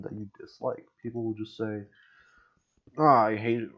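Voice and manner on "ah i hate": loud, exasperated mock outburst; mock exasperated complaint. Player griping before leaving the lobby, mock exasperation. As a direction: imitate a fed-up player, much louder.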